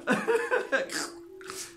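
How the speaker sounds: high, loud cackling laughter; amused laugh. Real, not acted. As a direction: burst out after the punchline. Laughs after grammar joke.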